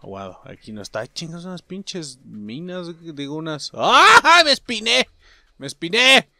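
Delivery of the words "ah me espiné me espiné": high, loud cry of ouch; pained yelp. Pretend. Player pricked by thorns in game, pained yelp.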